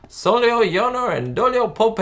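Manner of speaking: high, loud, hammed-up cowboy Southern accent; mock Southern accent. Fan imitating Ricky's cowboy act, exaggerated Southern accent. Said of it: "like, a southern accent".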